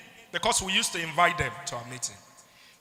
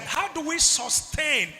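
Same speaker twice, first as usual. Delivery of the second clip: "sustain" drawn out and raised in pitch; stress on sustain; 7 semitones higher, slower than usual. Shown as a demonstration. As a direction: underline "sustain" by stretching it slowly. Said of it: "underline the word".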